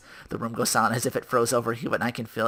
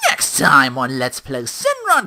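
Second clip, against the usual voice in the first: announcer outro; 3 semitones higher, 13 dB louder, slower than usual. Real, not acted. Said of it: "outro announcer".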